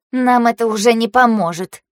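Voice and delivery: ordinary delivery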